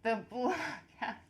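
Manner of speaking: slow and ominous; dramatic slow echo. Real, not acted. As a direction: drag out the name darkly. Echoes name dramatically, slow.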